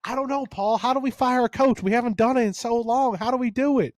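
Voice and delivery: high, helpless mock fan voice; imitating Alabama fan. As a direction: play a lost fan begging the host for help. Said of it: "and he's like".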